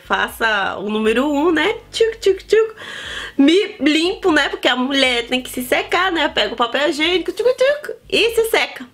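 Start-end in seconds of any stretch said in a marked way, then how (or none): 1.9-2.7 high playful "tchuc" sound effect; mock wiping noise. Describing the bathroom routine with a playful wiping noise. 7.3-8.0 high playful "tchuc" sound effect; mock wiping noise. Describing the bathroom routine with a playful wiping noise.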